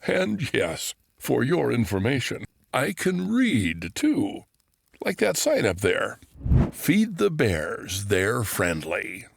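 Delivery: high, slow show-off bear voice; a bear boasting he can read; storybook bear proudly reading aloud; proud talking bear. Acted. Bear character voice.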